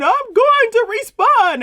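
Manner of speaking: high, slow mocking imitation of a crying rant; mocking whiny quote. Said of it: "standing there screaming and hollering".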